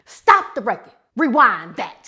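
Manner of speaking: loud, excited stop the record call; loud mock DJ stop call; Dave Chappelle quote. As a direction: shout it like a hyped host. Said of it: "what Dave Chappelle say?".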